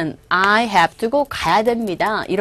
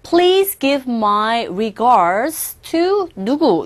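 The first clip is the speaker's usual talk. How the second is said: slow formal model line; much slower than usual. Shown as a demonstration. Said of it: "조금 격식을 차려서".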